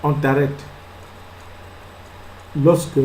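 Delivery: normal talking voice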